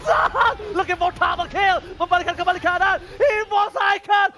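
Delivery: yelling the big play; shouting play-by-play. Acted. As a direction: scream the teamfight win. Teamfight climax casting.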